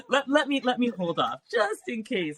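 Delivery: laughing. Real, not acted. Laughing at herself.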